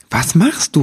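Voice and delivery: a touch of alarm in the question; agitated call. A narrator's reading. Elf woman questions her friend's spell, mildly agitated. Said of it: "rief Nibelwurz aufgeregt".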